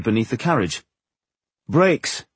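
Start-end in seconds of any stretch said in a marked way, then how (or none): 1.7-2.2 higher-pitched, pleased announcement; announcing. A narrator's reading. Dwarf names what he found under the wagon, announcing. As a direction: announce the discovery. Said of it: "he announced".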